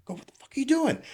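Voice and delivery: high-pitched incredulous question; retold disbelief. Officer to stranded colleague at the gear handle, incredulous. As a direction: act baffled, pitch jumping up. Baffled question to stuck driver.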